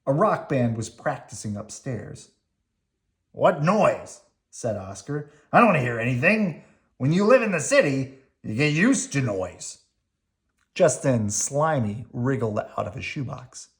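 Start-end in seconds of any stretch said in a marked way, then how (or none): 3.3-9.8 gruff grouchy Oscar; grumpy garbage-can grouch. Acted. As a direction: growl it like a grouch. Oscar voiced, louder.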